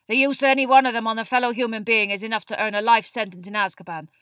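very loud, urgent classroom lecture; stern teacher Moody. A narrator's reading. Moody teaches the class what they must fight, loud and forceful. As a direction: hammer the warning home to the class.

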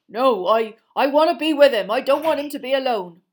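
loud, pleading half-giant; big upset Hagrid. A narrator's reading. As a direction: insist he must stay, voice straining. Hagrid begs to stay with Buckbeak.

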